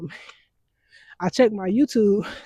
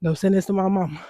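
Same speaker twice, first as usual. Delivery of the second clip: ordinary talk, nothing marked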